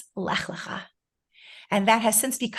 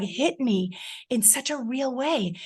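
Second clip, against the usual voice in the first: ordinary talk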